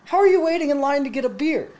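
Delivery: high-pitched retold disbelief; incredulous question. Teller to a stranger waiting for beer, incredulous. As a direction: question him in playful disbelief.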